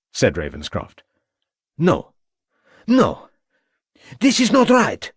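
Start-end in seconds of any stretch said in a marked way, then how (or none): 1.8-5.1 high, loud desperate French shout. A narrator's reading. Prisoner to inspector, shouting high in French accent. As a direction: cry out high in protest. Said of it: "shouted Hubert".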